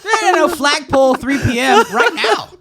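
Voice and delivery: high, loud, laughing fight call; hammed-up teacher shouting fight time; mock loud teacher. Mock teacher yelling fight setup.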